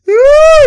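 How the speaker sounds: loud, very high drawn-out shriek; high-pitched squeal. Real, not acted. Reacting to Boban's play, a high excited shriek.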